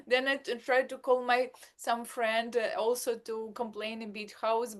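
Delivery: ordinary talk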